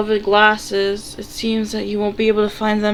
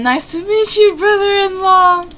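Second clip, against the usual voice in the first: much higher, louder teasing lilt; cheeky greeting; 10 semitones higher, 7 dB louder than usual. Acted. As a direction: play it bold and cheeky. Teasing bold reply to brother.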